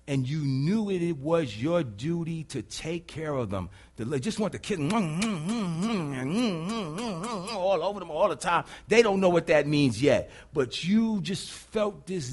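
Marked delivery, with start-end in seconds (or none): 4.8-7.5 humming "mm-mm" kisses in a rapid run; string of doting, sing-song mm's; playful kissing noises. Acted. Preacher showing a parent smothering a baby with kisses, playful kissing noises.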